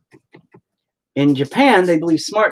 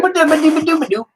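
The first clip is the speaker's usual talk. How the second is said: high, garbled mock mumble rap; mumble rapper mockery; 5 dB louder than usual. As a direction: mash the word into mumbled syllables. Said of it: "You can take your rapping somewhere else".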